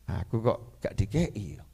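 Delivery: low, soft, slow musing to oneself; inner thought. Retold or imitated. Speaker silently wonders why he got none, hushed slow inner voice. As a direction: murmur it privately, slowly. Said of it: "Nah, saya batin".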